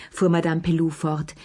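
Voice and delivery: plain reading voice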